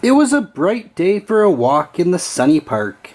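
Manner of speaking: ordinary delivery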